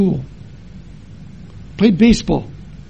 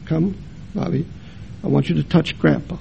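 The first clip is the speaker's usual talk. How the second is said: hushed mother; 8 dB quieter than usual. Retold or imitated. Mother coaxing a small boy.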